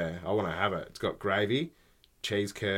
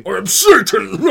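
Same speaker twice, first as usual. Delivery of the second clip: loud, higher cartoon devil roar with a laugh; Satan voice; 10 semitones higher, 14 dB louder than usual. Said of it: "Who is he? Satan".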